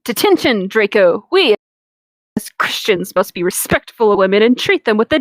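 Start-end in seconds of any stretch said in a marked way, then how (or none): none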